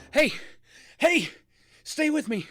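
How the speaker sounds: high, loud urgent cries; urgent calling out. Acted. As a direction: shout to keep someone conscious. Calls to an unresponsive injured person.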